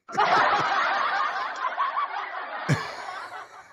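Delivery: a long, high, airy burst of laughter; high breathy cackle. Real, not acted. Host cackling at Kelly's comment in the chat, high breathless laugh.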